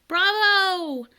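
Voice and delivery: high, loud chirpy bird cry; canaries shouting. Acted. Canaries cheering the dragon, loud and high. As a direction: cheer like a flock of small birds. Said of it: "while the canaries shouted".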